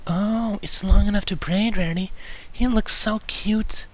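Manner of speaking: high, hushed, breathy gentle mare; slow gushing whisper. Acted. Fluttershy voiced by narrator.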